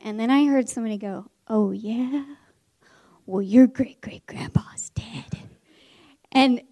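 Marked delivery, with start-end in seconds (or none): 1.5-5.3 slow, breath-heavy classroom jab; hushed taunt. Retold or imitated. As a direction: imitate a kid needling under their breath. Pupil taunts a classmate behind teacher's back.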